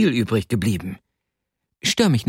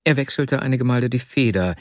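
plain reading voice

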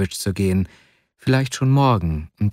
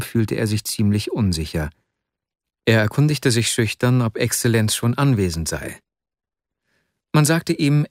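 plain reading voice

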